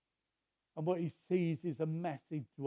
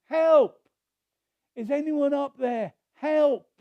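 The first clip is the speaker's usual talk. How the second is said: high desperate shouting; frantic shouts for rescue; yelling for help; 8 semitones higher, 9 dB louder, slower than usual. Retold or imitated. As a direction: call up the cliff at the top of the voice. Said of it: "He's yelling for help".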